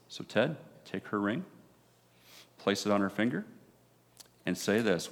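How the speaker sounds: ordinary talk, nothing marked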